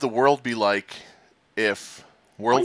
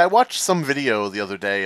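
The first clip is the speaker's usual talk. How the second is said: ordinary talk, nothing marked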